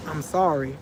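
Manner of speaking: very soft, low mimicked apology; imitated whisper. Friend apologizing a second time, imitated in a soft whisper. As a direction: barely let it out, like his whisper. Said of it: "He whispered again".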